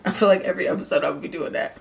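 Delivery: usual speaking voice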